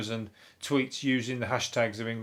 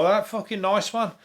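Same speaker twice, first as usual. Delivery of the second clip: higher, drawn-out mock approval; lightly voiced pleased owner; 7 semitones higher than usual. Owner admiring the cat's catch, lightly acted approval. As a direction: lightly act an impressed cat owner, slow and raised.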